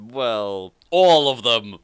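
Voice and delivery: ordinary talk, nothing marked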